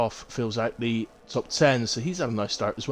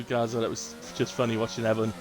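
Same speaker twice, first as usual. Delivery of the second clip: ordinary delivery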